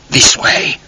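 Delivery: a low whisper close to her ear. A narrator's reading. Tall man leads Charlotte out of the crowd, whispering. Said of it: "said in her ear".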